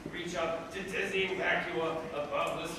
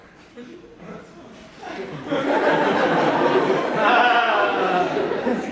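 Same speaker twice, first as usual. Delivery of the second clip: long helpless laugh, much louder; long loud laughter; 6 semitones higher, 12 dB louder than usual. Real, not acted. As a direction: lose it completely at the joke. Laughs at the children's book.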